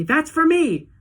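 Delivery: high and loud, grabbing the prize; excited shout. A narrator's reading. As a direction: shout it like a greedy kid. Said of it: "shouted Mike T V".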